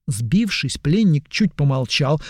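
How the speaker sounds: ordinary delivery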